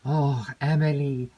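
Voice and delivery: ordinary delivery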